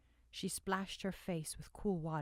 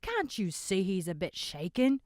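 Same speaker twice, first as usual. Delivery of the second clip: higher and louder, urging; raised voice defending a shaken man; pleading tavern voice; 8 semitones higher, 8 dB louder than usual. A narrator's reading. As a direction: press the others to let him speak. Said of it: "another voice drawled".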